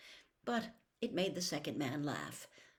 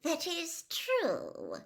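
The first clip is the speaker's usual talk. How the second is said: amused; 12 semitones higher, much slower than usual. A narrator's reading. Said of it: "sounding amused".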